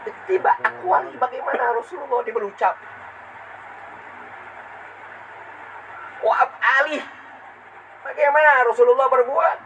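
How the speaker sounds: high, ringing sermon delivery; impassioned preaching. Acted. Preacher recounting the Prophet's devotion, high and fervent. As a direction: raise the voice in fervent exhortation.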